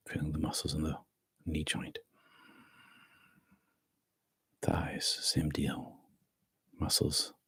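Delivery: soft, hushed meditation guidance; guided meditation whisper. Acted. Teacher guides the group through body relaxation, soft whisper.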